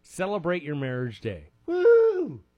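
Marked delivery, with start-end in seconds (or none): 1.6-2.4 loud, high "woo"; party cheer, much higher and louder; mock celebratory whoop. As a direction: let out a big high whoop. Mock cheer for marriage day.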